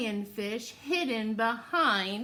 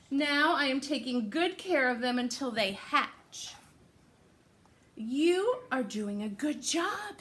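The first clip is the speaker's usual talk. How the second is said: put-on hook the kids with an excited start; animated picture-book read-aloud; reading to children; 3 semitones higher than usual.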